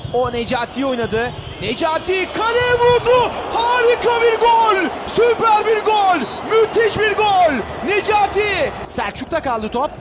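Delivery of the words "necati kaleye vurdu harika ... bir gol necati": shouted goal call; screaming football commentator. Real, not acted. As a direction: yell the goal in excitement.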